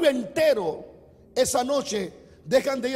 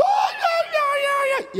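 high mock wailing cries of ay; hammed-up paid wailing; hired mourner wailing; 4 dB louder, slower than usual. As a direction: wail like a paid mourner at a wake. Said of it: "ella llegaba llorando".

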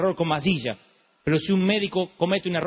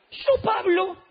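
much higher, mock-meek voice; Pablo con ese tono; 12 semitones higher, much slower than usual. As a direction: sound falsely gentle. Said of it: "pero no con este tono". (Pablo, tono agudo y falsamente manso)

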